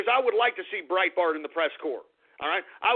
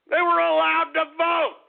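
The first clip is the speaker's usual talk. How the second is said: louder, higher insistence; emphatic repeat; 6 semitones higher, 4 dB louder than usual. Real, not acted. Host insisting to listeners that immigrants voted, emphatic. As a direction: drive it home hard. Hammering his point home.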